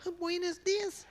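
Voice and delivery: extremely high, loud falsetto; piercingly high comic greeting, on the edge of laughter; odd high greeting voice. Retold or imitated. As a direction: imitate a friend's voice shooting sky-high. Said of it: "Why do I do that with my voice".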